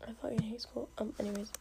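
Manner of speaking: hushed whisper throughout. Real, not acted. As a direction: keep it to a breathy whisper. Whispering late at night.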